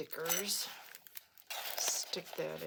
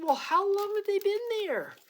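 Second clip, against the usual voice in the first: high, loud astonished question; surprised question; 9 dB louder than usual. Retold or imitated. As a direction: ask it in disbelief, pitch way up. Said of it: "I said to her".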